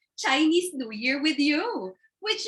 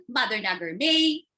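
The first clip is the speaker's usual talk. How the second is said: normal talking voice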